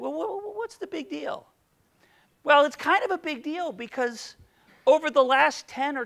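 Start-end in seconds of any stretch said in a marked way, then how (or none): 0.0-1.5 high, soft, skeptical voice; doubting listener. Retold or imitated. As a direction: play the unimpressed skeptic. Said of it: "You think".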